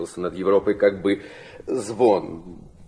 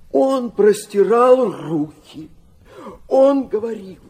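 tearful; 10 semitones higher, 6 dB louder than usual. Acted. Father describing his vision, moved.

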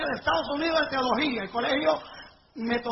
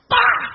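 loud smack sound; 9 dB louder than usual. Retold or imitated. Imitating shoe striking the pastor.

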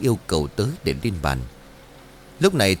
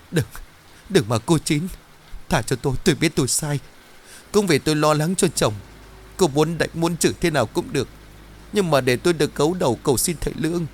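high, pleading wail for mercy; desperate begging; 6 semitones higher than usual. Acted. Trang clinging to Chín's legs, begging tearfully. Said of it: "gào thét bấu víu lấy chân của Chín rồi van xin".

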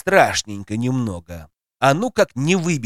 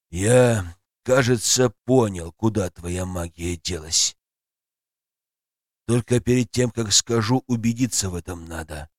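dragon voice; 5 semitones lower, 3 dB quieter than usual. Acted. Dragon Zmey speaks.

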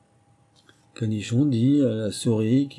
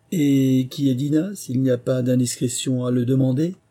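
politely curious lori; 5 semitones higher, 5 dB louder than usual. A narrator's reading. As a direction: draw out the polite enquiry, pitched up. Lori politely asks about Dina.

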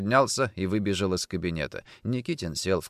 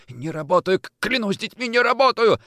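high, stammering choked oath; desperate denial; 11 semitones higher, 3 dB louder than usual. Acted. Kochkin swearing by his children, desperate denial. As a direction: stammer an oath, ending loud.

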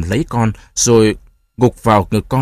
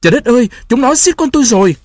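anguished wail; 12 semitones higher, 4 dB louder, faster than usual. A narrator's reading. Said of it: "cực quá gào to".

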